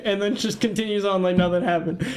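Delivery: ordinary talk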